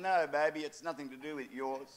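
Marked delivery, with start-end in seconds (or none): none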